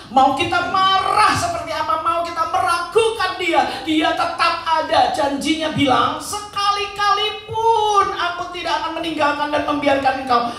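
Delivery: loud, high impassioned sermon delivery; full-force preaching. Real, not acted. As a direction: preach it out at the top of your voice. (khotbah berapi-api)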